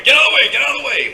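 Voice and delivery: high, loud crowd-clearing shout; mock guard yell. Security guys pushing everybody aside, loud high yell. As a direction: bellow it high, clearing a path.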